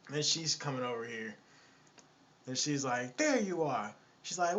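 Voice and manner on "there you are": higher-pitched mock woman's voice; irked woman arriving. Lady walks up on the man at the table, irked. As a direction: lightly play a woman catching him out. Woman finds him, story retold.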